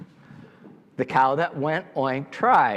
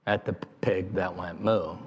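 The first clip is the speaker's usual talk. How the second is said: ordinary delivery, nothing marked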